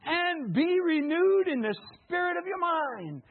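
loud, high, slow proclamation; preaching at full force. Acted. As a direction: proclaim it to the back row. Preacher peaking on scripture.

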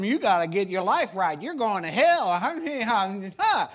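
loud hellfire scolding, dragging out hell; judgmental shouting. Retold or imitated. Preacher mocking his old self yelling at a sinner, loud and harsh. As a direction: bellow it like a street preacher condemning.

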